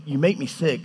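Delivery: calm, measured and unshouted; calm, not angry. Acted. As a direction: say it evenly, as a patient God. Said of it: "he's not like us".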